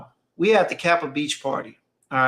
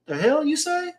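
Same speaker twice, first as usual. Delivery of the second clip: shocked dad; 11 semitones higher than usual. Retold or imitated. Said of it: "My dad hopped up and looked like".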